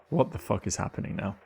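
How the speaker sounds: ordinary delivery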